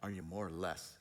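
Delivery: low, soft, sheepish question; a pastor sheepishly sharing his number, soft and low. Retold or imitated. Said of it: "sheepishly give their number".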